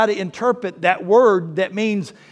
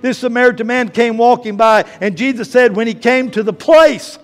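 louder, driving sermon voice; full-force preaching; 2 semitones higher, 7 dB louder than usual. Acted. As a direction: preach the parable to the rafters.

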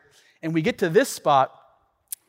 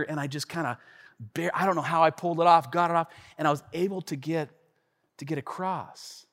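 ordinary talk

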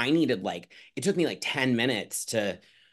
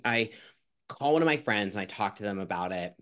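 usual speaking voice

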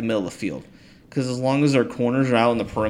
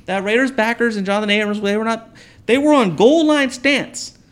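worked up; 8 semitones higher, 5 dB louder than usual. Real, not acted. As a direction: hammer the point home. Heated football argument.

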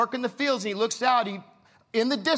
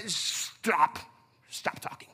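hushing father; 3 semitones lower, 4 dB quieter, much slower than usual. Acted. Father silencing repentant son.

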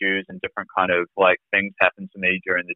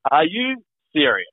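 retold disbelief, pitched up; higher, pointed question; incredulous challenge; 6 semitones higher than usual. Retold aha moment, challenges physio.

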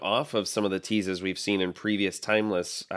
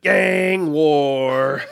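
loud, high, drawn-out mock announcement; big playful proclamation, held long; hammed-up title call; 5 semitones higher, 7 dB louder than usual. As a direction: belt out the title, stretching each word. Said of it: "which I will always say like that".

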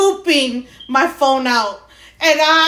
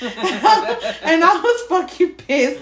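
laughing; 5 semitones higher, slower than usual. Real, not acted.

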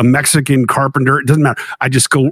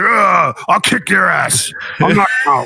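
put-on drunk threat; 4 semitones higher, slower than usual. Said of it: "He's like".

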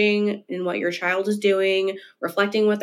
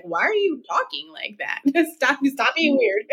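put-on higher, exasperated teen pushback, laughing; annoyed teen; 7 semitones higher than usual. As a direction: complain like an embarrassed teenager. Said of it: "It's been thrown in my face for sure".

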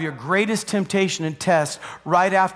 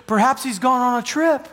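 higher-pitched mock-helpful taunt; lightly acted jeer, pitched up; 6 semitones higher than usual. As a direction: tease them with a pretend suggestion. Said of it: "he's mocking these guys".

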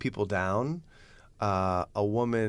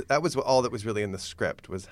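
normal talking voice

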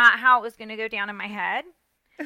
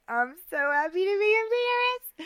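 much higher, gushing and delighted; excited; 9 semitones higher, 4 dB quieter than usual. Retold or imitated. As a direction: beam it out, thrilled to be there. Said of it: "I'm like".

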